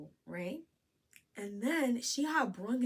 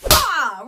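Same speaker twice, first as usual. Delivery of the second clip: loud, high "bah" burst; imitated slap impact; 6 semitones higher, 17 dB louder than usual. Storyteller mimics the slap landing, sudden loud impact noise. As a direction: blurt out a slap sound effect.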